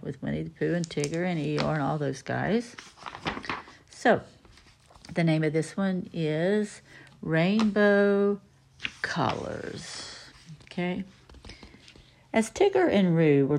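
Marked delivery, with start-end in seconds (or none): none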